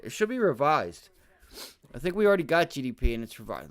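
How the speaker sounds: ordinary delivery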